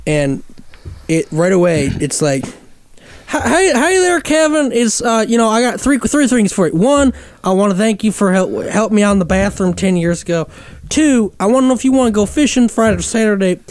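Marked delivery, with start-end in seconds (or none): 3.3-13.6 Southern hillbilly. Retold or imitated. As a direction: ham up a rambling Southern uncle. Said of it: "over-the-top Southern hillbilly caricature".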